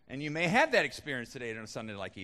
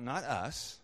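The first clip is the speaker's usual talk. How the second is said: usual speaking voice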